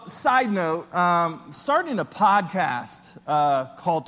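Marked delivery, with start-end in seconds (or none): none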